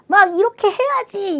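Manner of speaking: mock worked-up insistence; agitated, not calm. Shown as a demonstration. Teacher showing how not to give good advice, agitated. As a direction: push it out as if worked up. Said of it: "이게 아니라 정말 그 좋은 말도 차분하게".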